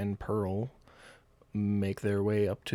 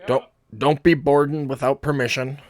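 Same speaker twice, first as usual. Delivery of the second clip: raised, loud in-character order; hollered order; 4 semitones higher, 8 dB louder than usual. Acted. Turtle warns party off boarding the ship, hollered order. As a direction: holler it down to strangers. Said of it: "spots you and hollers down".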